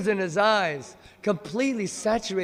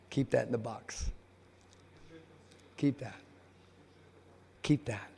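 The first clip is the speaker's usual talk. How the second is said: low, soft conspiratorial order; hushed instruction; 5 semitones lower, 10 dB quieter than usual. Retold or imitated. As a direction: quietly slip an instruction to a helper, acted. Gopis quietly instructing a helper.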